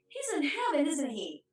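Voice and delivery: put-on small child stating it innocently; imitating little niece. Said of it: "She goes".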